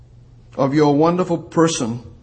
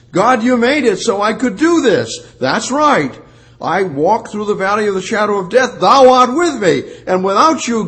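higher, louder fervent preaching; preaching at full force; 7 semitones higher, 6 dB louder than usual. Real, not acted. Preacher crying out to God before the congregation, fervent and loud. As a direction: lift it up and cry it out to God.

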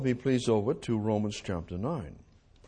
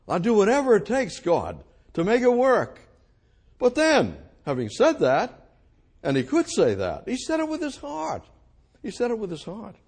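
higher, louder impassioned preaching; fervent preaching; 10 semitones higher, 6 dB louder than usual. Real, not acted. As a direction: preach it out at full force. Preacher at full force.

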